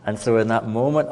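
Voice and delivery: usual speaking voice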